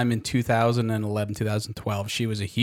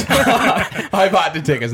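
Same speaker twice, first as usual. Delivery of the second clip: higher, slow, laughing through it; 6 semitones higher, slower than usual. Real, not acted. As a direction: laugh as you confess. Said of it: "Yeah, you're right. I'm full of shit".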